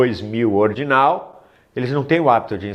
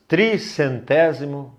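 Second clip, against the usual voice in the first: ordinary talk, nothing marked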